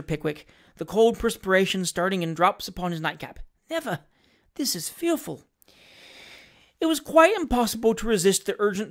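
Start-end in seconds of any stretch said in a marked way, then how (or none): 3.7-5.4 high, soft, fearful inner voice; hushed horror. A narrator's reading. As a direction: squeak it softly in dread. Pickwick's horrified thought behind curtains.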